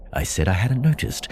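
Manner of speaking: plain reading voice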